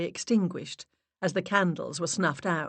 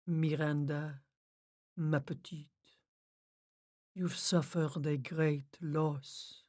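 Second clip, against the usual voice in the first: slow, soft, sympathetic medium; soft sympathy; 6 dB quieter, slower than usual. A narrator's reading. Medium consoles grieving Miranda, slow and soft. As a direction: comfort her gently and slowly. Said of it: "her voice low and soft and full of sympathy".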